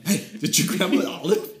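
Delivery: mock accusing. Brother caught stealing olives.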